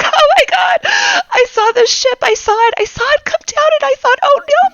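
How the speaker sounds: high, loud, tearful wail; crying in panic. Acted. Bitsy running up to the party, crying in panic. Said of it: "and she's crying".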